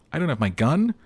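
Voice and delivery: the floor drops out from under him; shocked realization. Acted. Cop realizing his gun is gone, in shocked disbelief.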